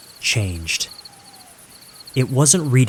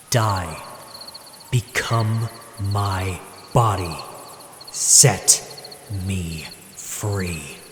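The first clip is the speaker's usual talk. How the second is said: ghostly demand; 3 dB quieter, much slower than usual. Acted. Demonic text on screen.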